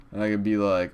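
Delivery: ordinary talk, nothing marked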